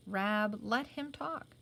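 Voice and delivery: usual speaking voice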